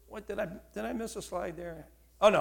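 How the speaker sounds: ordinary talk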